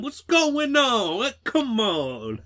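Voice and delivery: high, slow, drawn-out James Hong voice; mock James Hong. James Hong calling to Stallone, high, slow, puzzled. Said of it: "Hey, Stallone".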